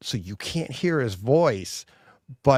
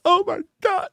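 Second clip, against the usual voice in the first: high, stunned oh my God; shocked gasp. Retold or imitated. Retelling his reaction to the movie, high and stunned. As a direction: let it burst out in shock. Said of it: "I was just like".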